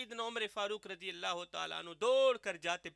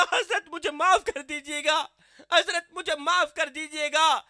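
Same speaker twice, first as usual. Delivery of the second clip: pleading tearfully; 6 semitones higher, 8 dB louder than usual. Acted. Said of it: "رو کر کہنے لگا".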